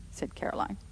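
ordinary delivery, nothing marked

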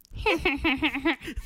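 high, hammed-up witch laugh; mock witch cackle. Guest witch greeting the host on Halloween, mock cackle. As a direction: answer with a fake witch's cackle. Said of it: "la sorcière".

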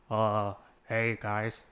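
low, sluggish sitcom office worker impression; low, halting character greeting; Kevin. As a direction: do a dopey low-voiced coworker. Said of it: "I sounded like Kevin from The Office".

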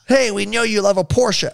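put-on cheerful American accent as a dealer greeting a Porsche fan. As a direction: slip into a friendly American salesman accent. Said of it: "Oh, I'm doing the accent again".

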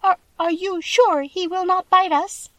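high, squeaky, hesitant voice; timid little mouse squeak; timid mouse queen. A narrator's reading. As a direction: squeak it timidly, stumbling at the start. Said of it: "asked in a timid voice".

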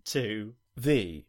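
slow, drawn-out segment announcement; announcer voice. Pretend. Co-host introducing the ranking segment, in a slow announcer voice. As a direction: present the ranking like a show host.

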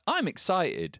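high-pitched excited child, retold; excited kid. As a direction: declare it like a newly convinced little kid. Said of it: "And he's like".

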